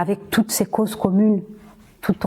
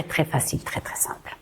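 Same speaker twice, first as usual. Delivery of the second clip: whispered; 3 semitones lower, 9 dB quieter than usual. Real, not acted. To her listeners, whispering that Swahili is simple. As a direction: drop to a hushed whisper on the easy part. Stressing how easy Swahili is.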